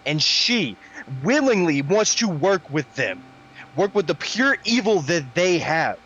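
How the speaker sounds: angry Iker rant. Acted. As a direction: let the anger build as you vent.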